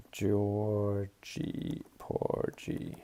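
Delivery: Georgie Porgy dragged out slowly and quietly, mumbled while typing; typing aloud. Real, not acted. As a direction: spell out Georgie Porgy under your breath, very slowly, letter by letter. Slowly typing the preset name.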